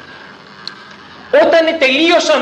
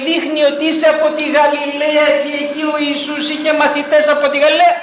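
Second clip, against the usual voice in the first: put-on pressing servant girl; 6 semitones higher, much faster than usual. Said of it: "μία δούλη".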